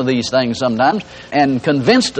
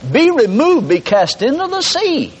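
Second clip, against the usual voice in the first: raised-pitch preacher's command; commanding a mountain; 9 semitones higher than usual. Acted. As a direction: order it away with high, emphatic authority. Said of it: "You say to the mountain".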